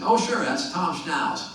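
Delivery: put-on high, drawn-out Virginia accent; badly faked slow Virginia accent; [name]'s terrible Virginia accent. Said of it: "This is a terrible Virginia accent".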